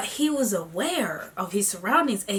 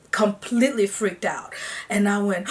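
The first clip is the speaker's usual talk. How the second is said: ordinary talk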